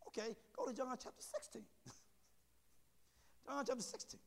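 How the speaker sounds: ordinary delivery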